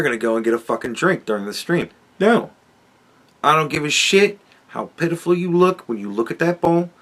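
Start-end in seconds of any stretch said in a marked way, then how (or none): 2.2-6.9 higher-pitched, put-on refusal; mock angry scolding. Streamer refusing his pleading dog a drink, mock angry scolding.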